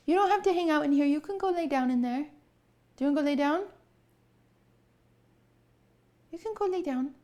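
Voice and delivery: high, sing-song coaxing of the cat; pet-talk voice. Pretend. Owner coaxing the cat Durs to go lie down in a high pet voice. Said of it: "doing my animal voice".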